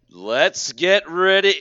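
loud, high, stretched-out hype call; booming, slow arena announcement; ring announcer call. Acted. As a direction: draw it out like a boxing announcer. Mimics a trademarked ring announcer call.

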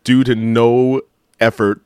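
ordinary delivery

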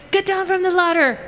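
high-pitched imitation of an officer's command; Border Patrol order. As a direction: call up a mock order to someone on a ladder. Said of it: "and they were like".

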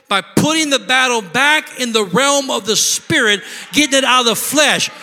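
loud, high preaching at full cry; full-force preaching. Real, not acted. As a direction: preach it hard to the congregation.

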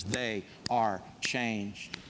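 slow, quiet and deliberate, each word weighed; slow solemn refrain. Real, not acted. Pastor closing each changed-life example, slow hushed refrain. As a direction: let the words sink in slowly.

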